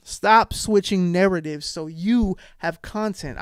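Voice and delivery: normal talking voice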